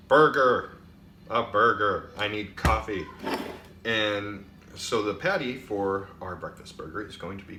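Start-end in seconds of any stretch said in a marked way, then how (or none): 0.1-2.1 much louder, insistent restatement; loud correction. Acted. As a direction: bark the word out to fix it. Correcting the word with a shout.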